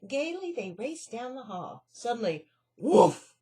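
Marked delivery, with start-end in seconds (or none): 2.8-3.3 loud angry woof; fierce dog bark. Acted. A fierce dog barking at the mice. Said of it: "barked a fierce and angry voice".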